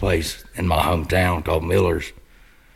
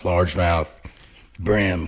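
ordinary talk

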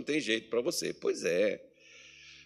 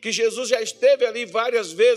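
higher, louder preaching push; fervent preaching; 8 semitones higher, 8 dB louder than usual. Acted. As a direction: drive the point home from the pulpit. Preaching builds up. (pregação fervorosa)

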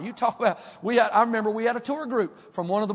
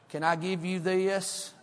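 slow North Carolina mountain drawl; Keith Dale; slower than usual. Retold or imitated. Soul winner to a New Yorker, slow mountain drawl. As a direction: stretch it out in a country mountain accent. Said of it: "he talks just like this".

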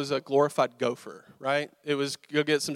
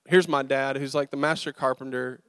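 ordinary talk

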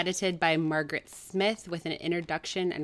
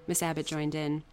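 ordinary delivery, nothing marked

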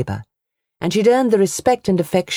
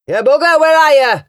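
a much higher, much louder furious yell; raging shout; 12 semitones higher, 7 dB louder than usual. A narrator's reading. Uncle hunting the girl in the barn, raging shout. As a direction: scream it out in fury. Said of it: "His voice shook with rage".

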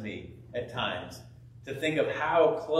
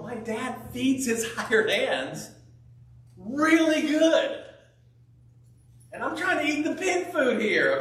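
voicing the prodigal son; 9 semitones higher, 4 dB louder than usual. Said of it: "He's going".

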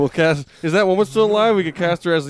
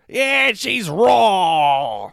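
angry boss; 7 semitones higher, 6 dB louder, much slower than usual. Pretend. Ramsay voice, raw meat joke.

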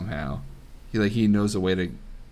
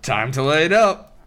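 dwarf punchline; 5 semitones higher, 5 dB louder than usual. Acted. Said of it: "And Marvis goes".

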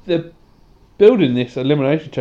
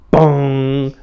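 bell bong; 4 dB louder than usual. Pretend. Imitating Undertaker's bell.

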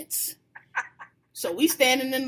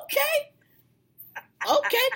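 impressed okay; 14 semitones higher than usual. Retold or imitated. Reacting to pretty girls arriving, impressed and much higher. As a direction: sound pleasantly taken aback.

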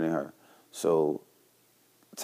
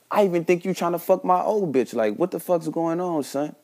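pitched up, heated accusation; accusing; 9 semitones higher than usual. Retold or imitated. To his friend Tyler, accusing him, pitched-up heat. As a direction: call him out, rising heat. Confronting friend about snitching.

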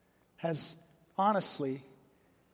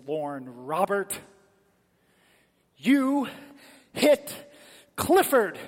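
word by word, accusing, rising to a loud pitch; stern mother scolding; 7 dB louder, slower than usual. Retold or imitated. Mom confronts her son over hitting Clifford, stern and building.

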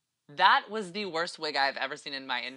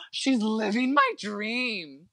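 high gasp, then slow, dreamy awe; awestruck gasp; 8 semitones higher, much slower than usual. Retold or imitated. Viewer recalling watching someone live their dream, awestruck. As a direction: act out being starstruck, drawn out. Acts out own reaction while watching.

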